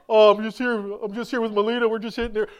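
lightly acted innocent explanation; retold phone reply. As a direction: answer lightly as if nothing is wrong. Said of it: "I go".

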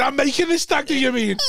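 high indignant boast; mock drunk boast. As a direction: sound like a cocky drunk.

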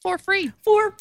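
high, hammed-up sales pitch repeat; sing-song pitchman echo; infomercial.

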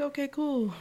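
high, forced casual; awkward okay. Retold or imitated. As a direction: play it cool but awkward. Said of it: "I was like".

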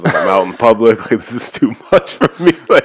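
retold panic, breaking into laughter; laughing. Telling a friend about tearing up in public, laughing. Retells almost crying in public.

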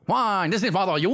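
over-the-top Cajun gibberish; high, hammed-up Cajun outburst; Cajun accent. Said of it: "do the fucking Cajun accent".

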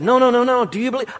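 high, quick, eager urging; excited insistence. Retold or imitated. Pastor urging the widower over his daughter, excited. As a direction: wave the daughter off and push on.

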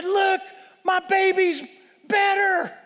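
high, loud joyful cry; excited mother. Retold or imitated. Mother runs up to pastor, excitedly shouting. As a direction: burst out with joy.

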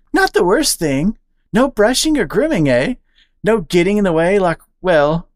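high, bouncy squirrel voice; excitable squirrel. Acted. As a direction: gossip eagerly to the new friend. Charlie chatting eagerly.

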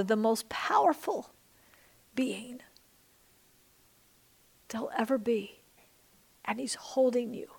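soft breathy guided-prayer hush; hushed prayer whisper. Acted. Pastor guiding the congregation to picture God, whispering. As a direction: whisper it gently, like a secret.

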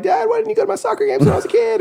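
put-on high, fast whiny kid plea; mock childish complaint. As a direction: whine like a hurt child. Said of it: "and I'm like".

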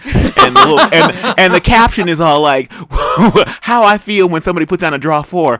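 laughing while telling it; high laughing retelling. Real, not acted. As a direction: giggles break through the words. Laughing through meme story.

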